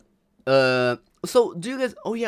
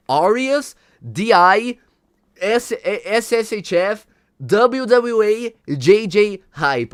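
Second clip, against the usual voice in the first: louder, slow letter-by-letter hype; mock hype read; 5 semitones higher, 7 dB louder, slower than usual. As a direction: announce the letters like a hype man. Reads viewer's plug aloud.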